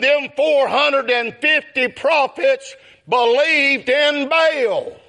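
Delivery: ordinary talk, nothing marked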